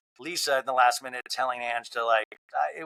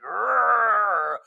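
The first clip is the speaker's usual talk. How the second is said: higher, louder frustrated growl; angry growl; 7 semitones higher, 4 dB louder than usual. Retold or imitated. Lisa sees the hug and growls, higher and louder. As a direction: growl like you're about to snap. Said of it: "gets triggered".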